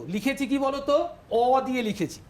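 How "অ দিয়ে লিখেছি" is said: usual speaking voice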